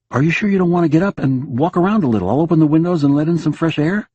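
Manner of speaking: husband urging his silent wife out of bed. Acted. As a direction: press her to get up.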